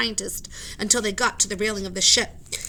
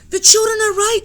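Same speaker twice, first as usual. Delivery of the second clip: high, very loud backing; supporting shout; 13 semitones higher, 13 dB louder than usual. Acted. Professor shouts to the captain, high and very loud. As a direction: shout to back them up. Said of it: "shouted the professor".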